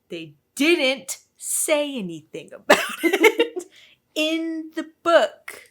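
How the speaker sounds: slow, drawn-out exasperated emphasis; word-by-word indignation with a laugh; emphatic complaint. Real, not acted. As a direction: stretch out every word to make the point. Pointed complaint about the book.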